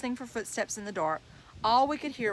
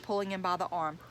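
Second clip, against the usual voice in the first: plain reading voice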